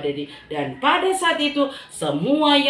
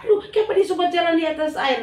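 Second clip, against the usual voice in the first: amazed exclaim; 7 semitones higher, faster than usual. Acted. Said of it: "Orang heran".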